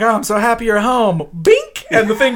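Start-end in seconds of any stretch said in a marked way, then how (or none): 0.0-1.3 a higher, sugary mock welcome; fake sweet girl. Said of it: "she's like". 1.4-1.9 squeaky bink sound effect; a sharp high boing for the bent knife; high bink noise. Retold or imitated.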